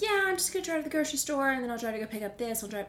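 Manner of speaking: high, loud and fast carefree mom; breezy mom voice. Retold or imitated. Imitating her mom's breezy plans to daughter, high and fast. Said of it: "my mom is like".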